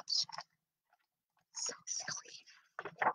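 soft whispered comment; whispered aside. Real, not acted. Quiet aside to viewers.

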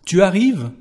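"Tu arrives?" asked high and loud; questioning tu arrives. Shown as a demonstration. Teacher asking a student whether they can manage, high and loud. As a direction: push "arrives" up as a real question. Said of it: "It's a question now".

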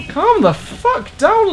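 very high, drawn-out protest; high-pitched road-rage whine; exasperated outburst. Real, not acted. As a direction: squeal it at another driver, slowly. Snaps at other driver.

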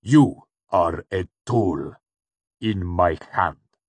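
forceful, deliberate cold menace; cool, hard repetition. A narrator's reading. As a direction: repeat it coolly, each word driven home. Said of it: "the cool voice repeated".

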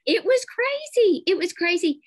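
excited exclaim. Real, not acted. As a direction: burst out in amazement at the huge class. Amazed at 420 students.

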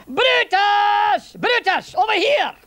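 high, loud Scottish summons; calling out. Acted. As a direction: call a soldier over in Scots. Centurion summons Brutus.

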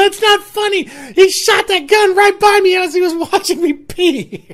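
loud, high-pitched mock shriek; hysterical protest. Scared friend protesting as they howl, hysterical. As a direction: imitate an outraged, panicked friend. Acted upset friend scolding laughers.

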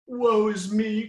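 high, slow hammed-up lament; mock woeful cluck. A narrator's reading. Smaller chicken mourning lost eggs, mock woe. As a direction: wail it like a melodramatic hen. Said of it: "clucked the smaller chicken".

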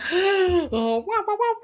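high-pitched mock wah-wah noise; imitated noise. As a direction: wail it out like a sound effect. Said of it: "you're so good at it".

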